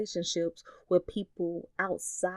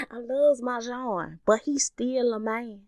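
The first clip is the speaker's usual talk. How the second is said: put-on higher country southern accent with a knowing fall; 6 semitones higher, 3 dB louder than usual. Southern auntie warning her niece about men, country southern accent. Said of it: "in her country southern accent".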